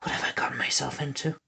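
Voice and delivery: fast whispered aside to herself; inner thought. A narrator's reading. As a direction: mutter it under your breath, exasperated. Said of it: "Winnie thought".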